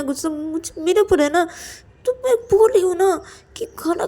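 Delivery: high, pressing entreaty; insistent pleading. Acted. Ananya tells angry Payal to eat first, insistent pleading.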